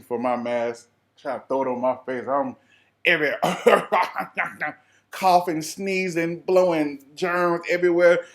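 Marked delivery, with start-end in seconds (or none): 3.4-4.7 a burst of real laughter; short laugh. Real, not acted. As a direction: laugh at the mess. Laugh at coughing chaos.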